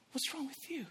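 high, very soft question; gentle concern. Retold or imitated. Evangelist to crying young woman, hushed high concern. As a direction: ask softly, as to someone crying.

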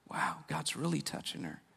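low, very soft wonder; hushed awe. Retold or imitated. Husband watching his wife wail, hushed awe. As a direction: murmur it under your breath. Brother awed as wife wails.

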